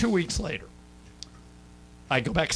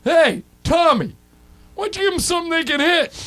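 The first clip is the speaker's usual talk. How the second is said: mock heckler's yell; 5 dB louder than usual. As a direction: call out to the mound from the stands as a joke. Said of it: "the guy yells from the stands".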